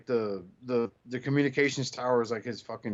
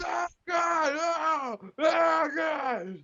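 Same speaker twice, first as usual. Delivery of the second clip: much higher, crying mock scream; mock panicked scream; much slower than usual. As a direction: wail it like a hammed-up terrified victim. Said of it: "And I was like".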